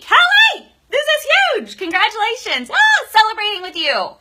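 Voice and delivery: loud, very high cheer; excited congratulations. Real, not acted. Host congratulates viewer on finishing a book manuscript, thrilled. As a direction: squeal the congratulations, celebrating with them.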